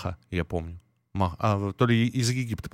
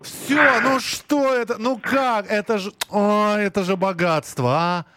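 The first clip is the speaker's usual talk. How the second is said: excited amazement; 9 semitones higher, slower than usual. Real, not acted. Host gasps over chewing gum.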